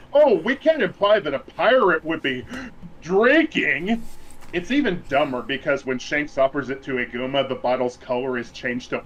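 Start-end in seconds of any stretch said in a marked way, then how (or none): 0.1-4.0 high, prissy mock outrage; mocking prissy censor. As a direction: lampoon a fussy network censor. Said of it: "other than".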